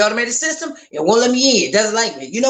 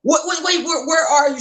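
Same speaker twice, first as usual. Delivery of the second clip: alarmed; 6 semitones higher than usual. Retold or imitated. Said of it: "And I'm like".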